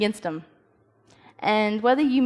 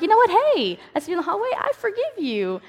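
mock sweet forgiveness; 10 semitones higher than usual. Pretending to forgive a girl in the hallway, sugary. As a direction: lay the fake magnanimity on thick. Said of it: "I'm just gonna be like".

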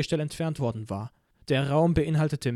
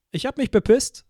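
bright, high, louder defiant cheer; cheerful; 8 semitones higher, 6 dB louder than usual. Acted. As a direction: gleefully confess to annoy her. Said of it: "sagte ich fröhlich".

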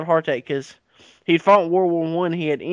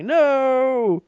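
mock terrified cry; 9 semitones higher than usual. Speaker fleeing the brain.

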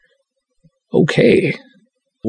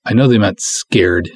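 usual speaking voice

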